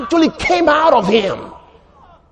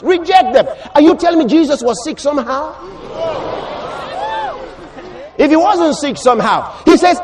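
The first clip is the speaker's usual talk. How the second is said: raised, insistent sermon voice; forceful preaching; 5 semitones higher than usual. Real, not acted. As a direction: build to a ringing, declamatory cry.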